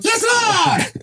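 very high mock-ecstatic cry. Host mimicking the singer in bliss, high mock shriek. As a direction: squeal it out in hammed-up rapture. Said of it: "dat hij schreeuwt".

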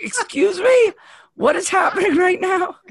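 high, loud incredulous shout; shocked outburst. Retold or imitated. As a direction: yell it in disbelief. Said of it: "I was like".

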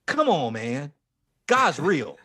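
ordinary delivery

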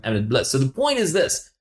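usual speaking voice

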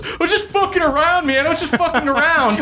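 high laughing nervous excuse; laughing backpedal. Acted. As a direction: laugh it off as she grabs her sword. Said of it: "and goes".

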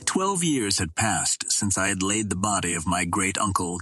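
ordinary delivery, nothing marked

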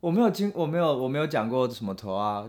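ordinary talk

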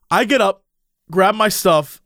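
normal talking voice

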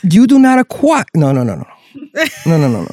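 high, loud preacher correcting; Southern preacher voice. Acted. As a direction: rebuke like a Southern pastor. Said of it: "my Southern preacher voice".

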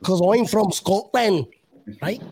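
slow, put-on Scottish accent; lightly hammed-up Scottish accent, drawn out; bad Scottish accent. Host shows off to Scottish guests, mock Scottish accent. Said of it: "my Scottish accent".

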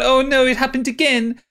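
high-pitched mock wail; mock crying. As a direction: imitate a tearful lament, lightly hammed-up. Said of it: "She starts to cry, going".

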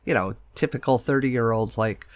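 normal talking voice

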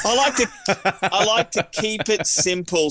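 laughing through the words; chuckling as he says it. Real, not acted. Laughing while explaining he keeps it simple.

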